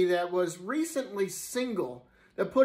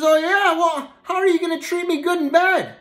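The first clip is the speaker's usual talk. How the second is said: woman's voice; 9 semitones higher than usual. Acted. Woman replying in joke.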